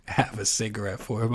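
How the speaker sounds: normal talking voice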